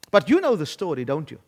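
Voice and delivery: ordinary delivery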